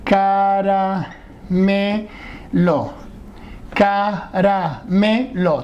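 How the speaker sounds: "caramelo" drawn out slowly, syllable by syllable. Shown as a demonstration. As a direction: spell out "caramelo" in slow syllables. ("caramelo" separado en sílabas, muy despacio)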